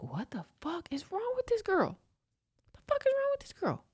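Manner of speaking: high, strained mock alarm; freaked-out disbelief. Driver being yelled at by her, freaked-out disbelief. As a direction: squeeze it out high, as if appalled. His reaction to her yelling.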